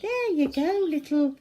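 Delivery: put-on high, loud baby-talk coo; tender coo. Zoe coos at the rat she is feeding. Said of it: "Zoe feeding the rat".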